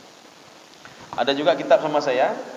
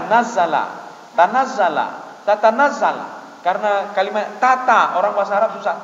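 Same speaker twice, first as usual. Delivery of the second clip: normal talking voice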